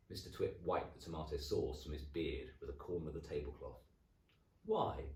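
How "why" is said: suspicious husband. Acted. Husband asking.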